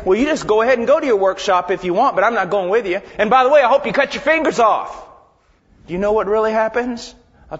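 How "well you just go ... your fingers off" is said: loud, quick sarcastic send-off; hammed-up scolding, building to a high finish; mock spiteful dismissal. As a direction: act out a huffy, vindictive God. Mock God, spiteful dismissal, as fundamentalists tell it.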